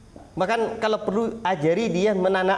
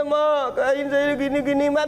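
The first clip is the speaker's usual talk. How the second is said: higher, whining child imitation; hammed-up childish complaint, pitched higher; mock whiny child; 6 semitones higher than usual. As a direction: imitate a homesick kid half-crying to his mother. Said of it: "Nangis-nangis dia".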